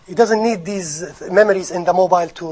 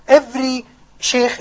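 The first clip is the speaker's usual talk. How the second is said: ordinary talk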